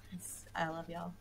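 hushed, softly confiding; soft aside. Real, not acted. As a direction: trail down into a near whisper. Aside to fans, teasing.

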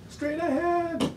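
very high, drawn-out lookout call; urgent calling. Acted. Reader voices lookout spotting a rock, urgent call. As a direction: call a warning across the boat.

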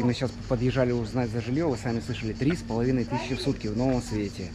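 normal talking voice

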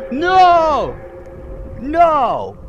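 sudden loud cry of dismay; shocked shout. Real, not acted. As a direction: yell no in disbelief. Teammate's death sound heard.

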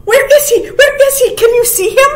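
high, loud, urgent questions; frightened doe. A narrator's reading. Frightened doe searching for the stranger, high and urgent. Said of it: "frightened doe stomped her hoof and snorted".

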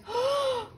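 high, sharp intake of breath; a quick startled gasp; shocked gasp. Acted. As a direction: gasp at the empty bowl. Bears discover the intrusion.

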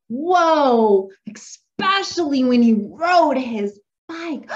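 gasped, slow, exaggerated wonder; loud, amazed read-aloud; excited storytelling. Shown as a demonstration. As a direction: stretch it out for a child. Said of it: "I'm gonna be making myself really excited".

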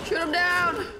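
shouting. Real, not acted. Panicked shouting during game fight.

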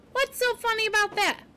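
high, quick-tempered little question; indignant. A narrator's reading. Poppy confronting the amused porcupine, indignant. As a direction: ask it pitched high, affronted.

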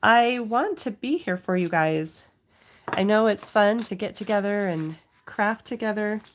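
normal talking voice

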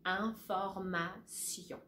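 Information slowly with s sound; slow French information. Shown as a demonstration. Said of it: "it's gonna be a s".

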